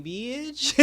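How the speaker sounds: high, stretched mock disbelief; incredulous retort. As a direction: squeal it like you can't believe the request. Retold Jane balks at going along.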